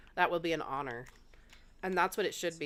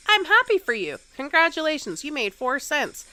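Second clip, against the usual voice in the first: excited congratulations; 11 semitones higher, 8 dB louder than usual. Retold or imitated. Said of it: "that's all you have to say".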